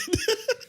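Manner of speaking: high squealing laugh. Real, not acted. Laughing at burp story.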